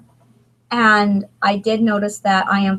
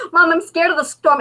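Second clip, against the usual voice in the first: daughter's voice; 8 semitones higher, 3 dB louder than usual. Retold or imitated.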